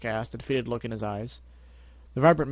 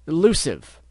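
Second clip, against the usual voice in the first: barked name, much louder; sharp scolding call; 4 semitones higher, 10 dB louder, slower than usual. Acted. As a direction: snap his name to stop him.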